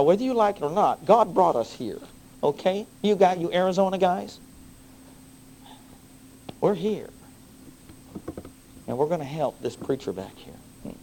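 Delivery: loud, high fervent preaching; preaching at full force. Acted. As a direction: drive it home from the pulpit.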